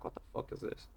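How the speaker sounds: whispered. Retold or imitated. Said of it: "you're like".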